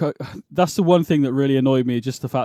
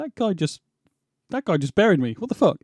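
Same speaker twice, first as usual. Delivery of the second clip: delayed realization; 5 semitones higher than usual. Retold or imitated. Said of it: "you go".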